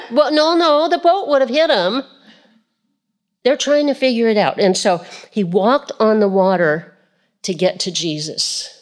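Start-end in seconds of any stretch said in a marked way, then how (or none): none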